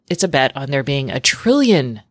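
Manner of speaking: ordinary talk, nothing marked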